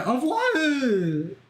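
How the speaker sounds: high, drawn-out "what"; baffled question. Real, not acted. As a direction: let the disbelief stretch the word upward. Baffled by the acronym answer.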